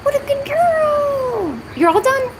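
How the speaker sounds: very high, slow cooing; drawn-out praise for the cat. Acted.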